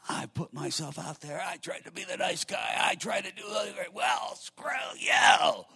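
hammed-up outburst of wounded pride; mock spitting mad. Pastor acts out his younger self's angry reply to the email. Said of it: "I would have been just spitting mad".

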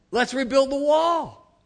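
slow, high, loud party-crowd chant; rally the crowd like a frat party; Animal House rally cry. Retold or imitated. Nehemiah's crowd roars back in Animal House style. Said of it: "my best Animal House interpretation".

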